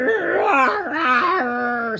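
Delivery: loud put-on monster roar; sea monster roar. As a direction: growl it like a hungry sea beast. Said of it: "said the sea monster".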